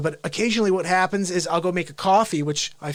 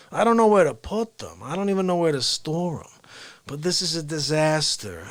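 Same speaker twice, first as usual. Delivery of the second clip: Cuomo. Acted. Governor telling everyone about the ventilator shortage, in Cuomo impression. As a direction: do a laid-back New York politician. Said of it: "he wants me to read this as Cuomo".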